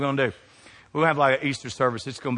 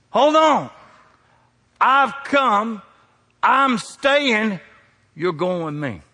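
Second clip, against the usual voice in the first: voice of God; 7 semitones higher, 4 dB louder, much slower than usual. Retold or imitated. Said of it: "you know what God's telling me".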